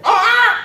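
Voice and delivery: loud, high parrot screech; sudden high squawk. Acted. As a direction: let out a piercing parrot squawk. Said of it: "The parrot goes".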